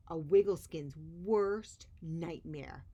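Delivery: usual speaking voice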